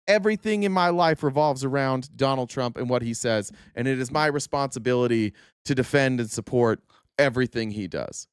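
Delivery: low, dull mock voice, dropping away at the end; mocking Trump supporter. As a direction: sneer as a brainwashed fan. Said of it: "just being like".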